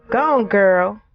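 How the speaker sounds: put-on husband teasing his wife as he leaves, in a country accent. Playful country accent, fond send-off. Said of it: "in his country accent".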